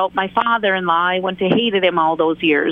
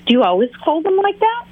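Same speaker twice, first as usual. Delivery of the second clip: lightly voiced nosy interrogator, higher; a hint of fake curiosity, pitched higher; 8 semitones higher than usual. Friend imitating the towel-folding interrogator, lightly voiced and higher. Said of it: "the interrogator goes".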